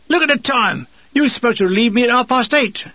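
high, indignant scolding; enraged. A narrator's reading. Enraged soldier waking his late relief, indignant scolding. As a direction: scold him in outrage. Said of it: "by an enraged Ernie Hart".

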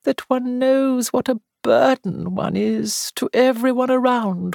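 high, feeble complaining voice; melancholy invalid. A narrator's reading. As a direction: whine gently about your burdens. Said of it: "in a melancholy tone".

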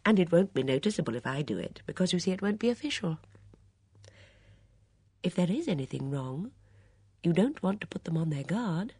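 a hint of a careful, advising old lady; Miss Marple. A narrator's reading. Miss Marple speaks in story.

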